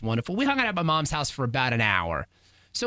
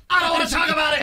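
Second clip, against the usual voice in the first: "I don't wanna talk about it" snapped out louder after a sigh; huffy yell, imitating wife; 11 semitones higher, 6 dB louder, faster than usual. Wife in the car ending the argument, louder huffy yell. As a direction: slam the door on the topic, louder. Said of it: "she got real huffy on me".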